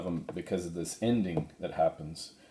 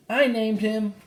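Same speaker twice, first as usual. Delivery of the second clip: put-on much higher proud girl's voice; Annie proudly; 4 dB louder than usual. Annie telling the cowboy she named the foal, higher proud voice. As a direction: beam as young Annie.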